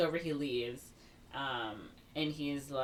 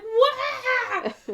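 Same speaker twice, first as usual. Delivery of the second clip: very high, loud squealed wail; mock screaming; 9 dB louder than usual. Ariel screams through the dance scene, hammed-up shriek. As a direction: shriek it in mockery, half laughing. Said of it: "she's just, like, screaming".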